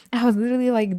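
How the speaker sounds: usual speaking voice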